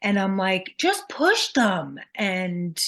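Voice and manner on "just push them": slowed, pitched-up insistence; frustrated mother. Retold or imitated. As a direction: push it out, exasperated. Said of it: "I just was so mad at him".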